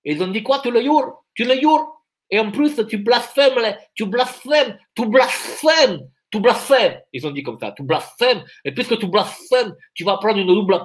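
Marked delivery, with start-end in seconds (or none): 0.6-2.0 a hammed-up Spanish accent, higher; mocking Spanish accent question. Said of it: "bloqué sur l'accent espagnol". 2.3-7.0 hammed-up Spanish accent, higher, dragging the repeats; mock Spanish accent. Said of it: "bloqué sur l'accent espagnol".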